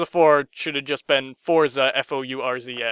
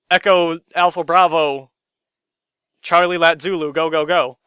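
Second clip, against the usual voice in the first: military radio callout; 5 dB louder than usual. Retold or imitated. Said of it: "military speak".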